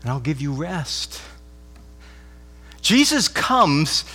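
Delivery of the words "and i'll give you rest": soft, hushed, welcoming call; gracious invitation. Retold or imitated. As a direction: invite the weary in gently. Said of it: "A gracious invitation".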